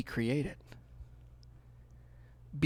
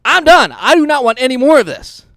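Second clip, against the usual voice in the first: emphatic quote; 8 semitones higher, 13 dB louder than usual. Retold or imitated. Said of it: "I get you saying".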